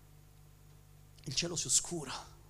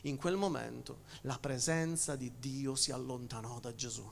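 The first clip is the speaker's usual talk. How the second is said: usual speaking voice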